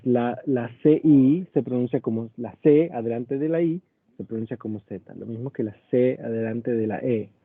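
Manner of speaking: ordinary talk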